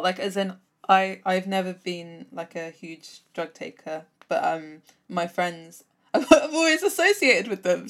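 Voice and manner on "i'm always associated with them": high, loud, through laughter; laughing. Real, not acted. Speaker admitting a link to druggy friends, laughing. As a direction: let the laugh carry the words.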